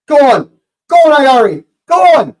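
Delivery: rising, loud urging of the attacker; breathless fan urging a shot; urgent shouting. Real, not acted. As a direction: yell him on toward goal, pitch climbing. Chance on goal for Brighton.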